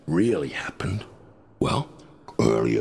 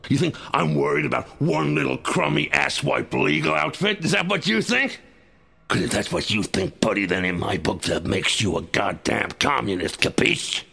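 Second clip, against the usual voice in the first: rising, belligerent tirade, higher and higher; angry rant; 8 semitones higher than usual. Acted. Agent taunts the listener about his critics, getting angrier. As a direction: spit the contempt at him, daring him to disagree.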